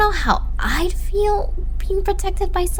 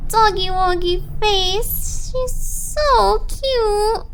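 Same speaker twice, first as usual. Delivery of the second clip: fond coo; 6 semitones higher, much slower than usual. Acted. Missing her dog's face.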